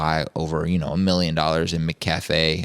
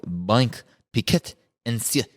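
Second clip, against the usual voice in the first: put-on halting stab at a Swiss accent; slow, unsure Swiss-accented reading; attempted Swiss accent; 2 semitones higher than usual. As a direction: sound out the bank name in a mock Swiss accent. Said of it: "That was the best Swiss accent".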